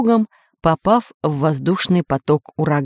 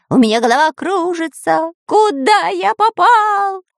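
dizzy panicked cry; straining; 11 semitones higher than usual. A narrator's reading. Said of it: "продолжал надрываться Дырка".

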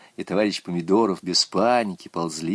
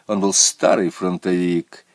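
usual speaking voice

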